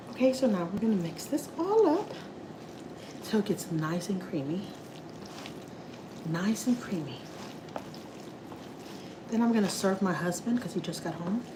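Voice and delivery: soft, quiet imitation of a gentle TikTok cook; softest voice. Mocking the soft-spoken TikTok cook to viewers, in a soft, quiet voice. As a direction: hush it down like a sweet voice putting you to sleep. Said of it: "That's how she talks".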